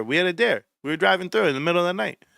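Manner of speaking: ordinary talk, nothing marked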